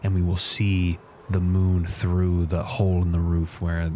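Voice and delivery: slow, hushed, solemn pronouncement; in-character war leader. Acted. Leader telling warriors of the nightfall plan, grave and slow. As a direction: lay out the plan gravely, unhurried.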